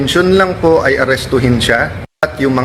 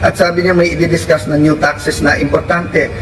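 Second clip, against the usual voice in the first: ordinary talk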